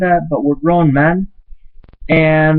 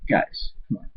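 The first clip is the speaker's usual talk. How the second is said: exasperated whisper; 7 semitones lower, 13 dB quieter than usual. Real, not acted. Pleading with bowlers.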